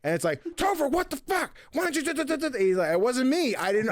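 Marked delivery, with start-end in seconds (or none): 0.6-2.6 high-pitched, hammed-up scolding; mock outrage. Host retells a typo complaint to Topher, in mock outrage. 2.9-3.9 higher, lightly hammed-up denial; mock protest. Host acts out Topher dodging blame, protesting.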